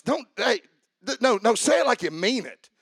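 normal talking voice

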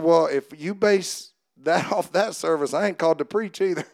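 retold comeback that breaks into laughter; laughing through a joke. Joking reply retold, laughing.

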